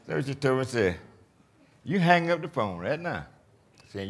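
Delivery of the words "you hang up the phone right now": higher-pitched imitation of insistent nurse; retold urgent order. Patient quoting the nurse sending him to doctor, urgent order. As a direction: retell it as a firm command.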